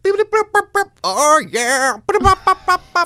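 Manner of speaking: high loud mock electronic beeps; phone beeping noises. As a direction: beep like a phone dropped in water. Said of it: "I just heard".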